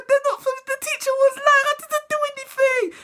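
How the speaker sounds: very high, half-laughing wail; hammed-up terrified child; mock panicked kid.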